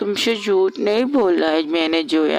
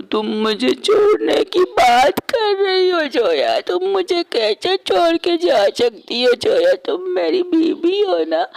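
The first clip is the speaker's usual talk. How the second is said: put-on drunk pleading; 6 semitones higher, 3 dB louder than usual. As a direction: slur it out like a tipsy husband clinging on. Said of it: "नशे में नहीं". (नशे में ऊँची, तेज़ आवाज़ में गिड़गिड़ाना)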